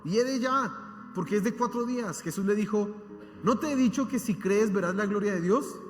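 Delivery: loud, impassioned preacher reading the Bible aloud; fervent scripture reading. Acted. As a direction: proclaim the passage to the congregation. Reads scripture at full force.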